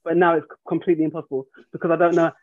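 normal talking voice

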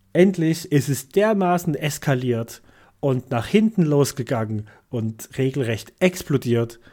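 normal talking voice

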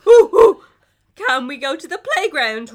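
put-on high, very loud, excited kid voice; wide eyed naive. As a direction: squeal like a bouncing child. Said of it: "Ray asks, jumping up and down".

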